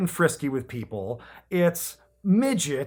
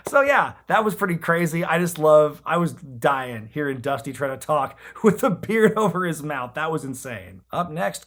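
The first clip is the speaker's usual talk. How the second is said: ordinary delivery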